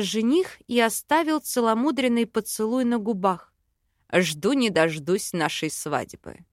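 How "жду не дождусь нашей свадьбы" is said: low, slow male character voice; loving fiancé. A narrator's reading. Fiancé looks forward to wedding with heroine, low and slow. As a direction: drop low and take it slowly.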